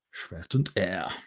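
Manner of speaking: German title, slow in an amateur German accent; terrible German accent. Shown as a demonstration. Author showing off his translated books, terrible German accent. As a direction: read it slowly with a clumsy German accent. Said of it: "Sorry for my terrible German accent".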